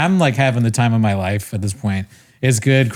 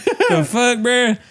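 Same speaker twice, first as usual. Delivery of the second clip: mock disbelief; 11 semitones higher, slower than usual. Comic reacting to a flat answer, high mock disbelief. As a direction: imitate a comic thrown by the answer.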